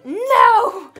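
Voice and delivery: high, loud wailed "No!"; desperate cry. Retold or imitated. The kid being hit, crying out loud. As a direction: cry out in protest. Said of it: "He's like".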